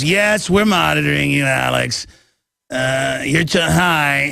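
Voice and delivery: lightly put-on secret agent voice; a hint of a mysterious spy; mimicking a famous spy. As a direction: imitate the spy's odd way of talking, half-joking. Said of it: "And he talks like that".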